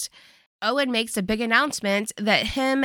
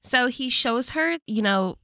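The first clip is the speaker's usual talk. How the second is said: usual speaking voice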